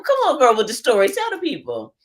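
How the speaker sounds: ordinary talk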